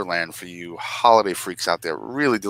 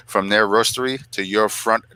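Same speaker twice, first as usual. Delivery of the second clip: ad slogan; 4 semitones higher, much slower than usual. Acted. Ad slogan read.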